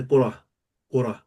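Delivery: "qura" closed on a puff of h; "qura" with an h in place of "tun"; h at the end. Shown as a demonstration. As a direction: breathe out a small "ha" to end "qura". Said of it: "ha, ha, at the end".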